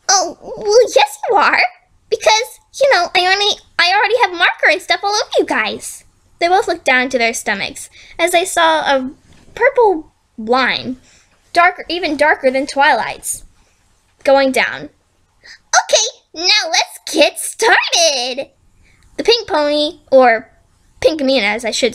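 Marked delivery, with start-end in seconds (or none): 0.1-6.0 chirpy villain. Acted. Villain cheerfully contradicts. 15.4-18.5 high cheery start, then drawn-out slow; sinister cheer. Acted. Villain to her captives before surgery, sinister cheer.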